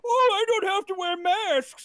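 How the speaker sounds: very high, slow, drawn-out mimicry; singsong mock complaint; mocking whine. Old man mocks maskless customers.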